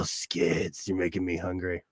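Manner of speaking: ordinary talk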